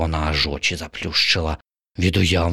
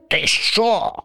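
indignant crow. Acted. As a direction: take offence. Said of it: "обурилася ворона".

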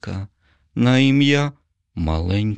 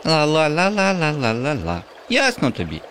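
put-on much higher, singsong girlish taunt; teasing girl; 8 semitones higher than usual. A narrator's reading. Little U taunting Bob in a high singsong. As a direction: chant it to tease him like a cheeky child.

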